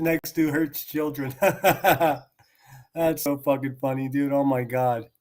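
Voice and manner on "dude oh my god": ordinary talk, nothing marked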